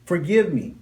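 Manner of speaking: ordinary talk, nothing marked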